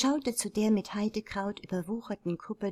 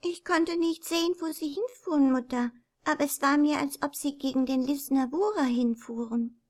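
put-on little girl; 6 semitones higher than usual. A narrator's reading. Little girl answers her mother.

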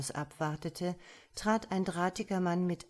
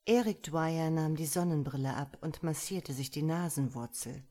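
plain reading voice

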